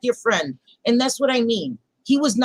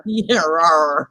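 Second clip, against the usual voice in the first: low playful rawr; dinosaur voice; 7 semitones lower than usual. Pretend. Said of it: "That's my dinosaur face".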